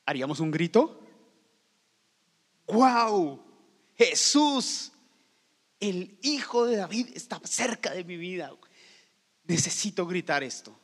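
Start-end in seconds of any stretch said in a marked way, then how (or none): 2.7-8.5 an amazed shout of praise; excited cry. Pretend. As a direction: burst out in wonder. Imagined shout of joy.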